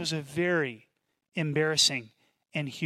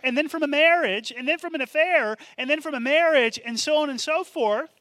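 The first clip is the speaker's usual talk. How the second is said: rising to a loud, high preaching cry; building preaching shout; 8 semitones higher, 5 dB louder than usual. Acted. Preacher voicing Jesus to the woman, building to a shout. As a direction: preach it louder and higher as Jesus.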